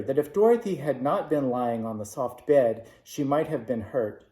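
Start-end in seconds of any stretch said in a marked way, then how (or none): none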